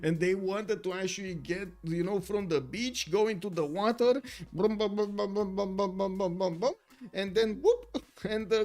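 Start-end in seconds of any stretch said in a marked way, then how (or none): 4.5-8.0 chugging "brum, bum, bum", high and loud; imitated car engine. Streamer mimics a car driving into the water, engine noise. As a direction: make a putt-putt motor noise, then a whoop.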